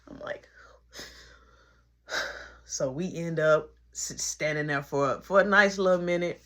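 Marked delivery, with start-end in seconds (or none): none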